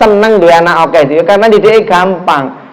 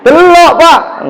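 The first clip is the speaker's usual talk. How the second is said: the same plea pitched higher and drawn out slowly; 10 semitones higher, slower than usual. Retold or imitated. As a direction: stretch "Delok, Pak." high and slow, coaxing the angry husband. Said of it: "Yo, bapak tetap nesu". (istri membujuk suami yang marah, suara tinggi dan lambat)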